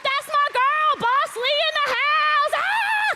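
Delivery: high, loud gushing fan voice; mock fan excitement. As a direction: cheer like a fan spotting a star. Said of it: "You're like".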